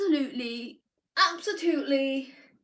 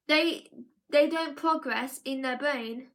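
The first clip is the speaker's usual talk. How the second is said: ordinary talk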